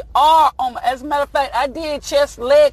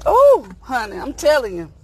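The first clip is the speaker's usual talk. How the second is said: ordinary talk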